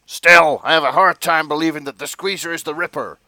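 police chief doubting his detective, loudly; shouty police chief. Acted. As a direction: bluster like a fed-up boss. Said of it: "police chiefs should sound a little bit like".

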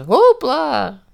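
high, loud mock circus cry; hammed-up high cheer; playful whoop. Voicing thrown granny's shout.

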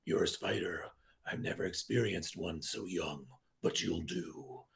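whispered, menacing villain line; horror movie villain. Retold or imitated. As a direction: murmur it like a predator sizing up prey. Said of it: "classic horror movie dialogue".

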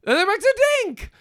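high-pitched gleeful echo; over-excited squeal of a repeat; mock excited echo. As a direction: parrot it back way up high. Echoes partner's line with mock excitement.

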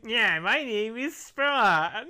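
hammed-up celebrity impression, laugh breaking in; Jason Marsden. Joking to a co-host as the actor who played Spawn, hammed-up impression. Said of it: "That's my Jason Marsden impression".

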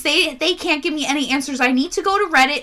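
high, loud hammed-up panic; mock distraught. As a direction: imitate a frantic girlfriend pleading to strangers. Mocking distraught girlfriend's Reddit plea.

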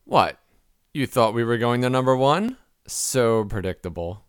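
low, slow show-announcer delivery; dramatic low-pitched reveal; mock announcer. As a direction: announce it with drawn-out ceremony. Announces the blooper reel.

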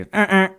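high, loud double honk imitation; bike horn honk. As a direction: mimic two squeezes of a bike horn. Imitates honking while riding downhill.

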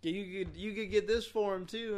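usual speaking voice

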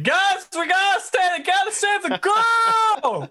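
high strained panicked yell; panicked shout. Pretend. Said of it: "I'd have been like".

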